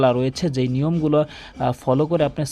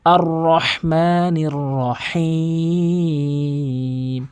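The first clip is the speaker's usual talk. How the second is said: very slow, drawn-out recitation with throaty h; Quran recitation; much slower than usual. Shown as a demonstration. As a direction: recite "আর রহমানির রহিম", holding the last vowel long. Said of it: "বলতে হবে". (টেনে কোরআন তেলাওয়াত)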